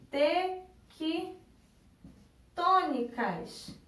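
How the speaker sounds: "tequitônicas" very slowly, pausing between the parts; syllable by syllable. Shown as a demonstration. Teacher presenting the proparoxytone word, "tequitônicas" sounded out slowly in parts. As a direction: break "tequitônicas" into slow, separate pieces. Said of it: "que é a palavrinha".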